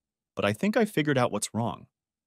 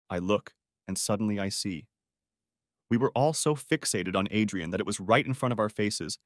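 ordinary delivery

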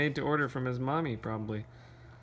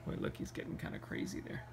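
whispered aside; 14 dB quieter, faster than usual. Real, not acted. Aside while reading to child.

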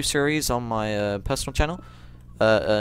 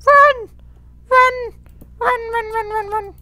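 very high, loud repeated run; panicked urging; 10 dB louder, slower than usual. Real, not acted. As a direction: yelp it over and over in panic.

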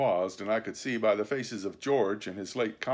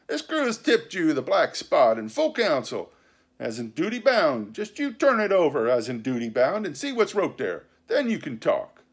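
raised, louder formal pirate challenge; stern pirate crewman; 6 semitones higher, 6 dB louder than usual. A narrator's reading. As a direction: lay down the crew's verdict.

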